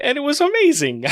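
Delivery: laughing. Real, not acted. Laughing with joy.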